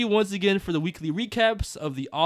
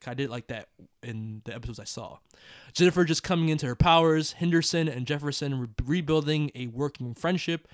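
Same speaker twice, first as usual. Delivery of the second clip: ordinary delivery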